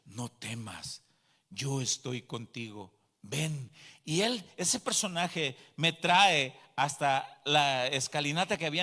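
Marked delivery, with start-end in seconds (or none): 0.1-3.7 low, gentle voice of reassurance; calm the frightened one, dropping low; robed heavenly figure. Retold or imitated. Robed figure to the terrified convert, low and calming. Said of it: "y me dice".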